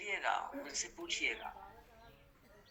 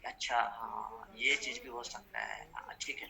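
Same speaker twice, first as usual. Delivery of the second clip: dawning understanding; 8 semitones lower than usual. Acted. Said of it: "Sunil जी को बातें समझ में आएंगी".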